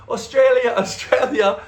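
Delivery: loud, high mock cheer; mock hype call. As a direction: belt it like a club hype man. Club hype chant, retold.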